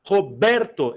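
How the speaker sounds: "Roberto" with a strong, drawn-out BER; stressed syllable. Shown as a demonstration. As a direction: lean on the middle syllable of "Roberto". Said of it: "é classificada quanto à tonicidade".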